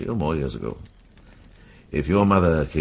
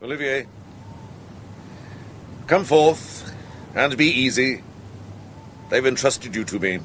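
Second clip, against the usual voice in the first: calling out; 10 semitones higher than usual. Acted. Calling to a man in hiding.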